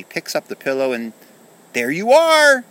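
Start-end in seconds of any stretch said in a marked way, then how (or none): 1.7-2.6 high, loud scream of discovery. Acted. Kenny finding the hidden soldiers under the pillow, screamed. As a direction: scream it as you find them. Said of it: "he screams".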